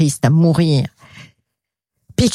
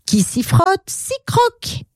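high-pitched chant-like motto; gleeful cry; 13 semitones higher than usual. Acted. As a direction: call it out gleefully, climbing very high. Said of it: "Et ils criaient". (cri joyeux, très aigu)

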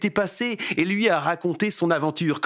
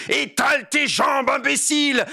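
furious yell; 8 semitones higher than usual. Acted. The daughter yells at Dégourdi in a rage. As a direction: bellow it at the fool. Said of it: "lui hurle dessus".

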